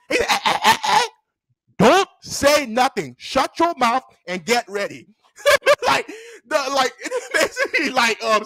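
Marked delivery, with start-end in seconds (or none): 0.3-5.1 mock-stern, measured telling-off; scolding teacher. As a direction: imitate a strict teacher silencing a pupil. Teacher cuts off protesting pupil.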